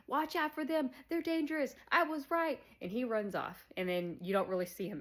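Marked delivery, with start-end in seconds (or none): 0.1-2.6 high, frantic warning, much higher; paranoid warning. Retold or imitated. Buckley telling everybody, high and frantic. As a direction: warn everyone in a high panicky voice. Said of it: "now he's telling everybody".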